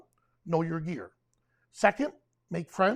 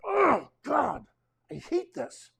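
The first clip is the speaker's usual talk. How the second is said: frustrated; 6 semitones higher, slower than usual. Retold or imitated. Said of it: "I'm getting frustrated with my camera gear".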